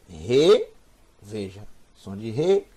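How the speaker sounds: "Re" with a light, aspirated h at the start; very weak sound. Shown as a demonstration. Teacher showing the Hebrew letter's sound, very weakly aspirated. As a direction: let "Re" begin on a faint puff of h. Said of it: "bem fraquinho".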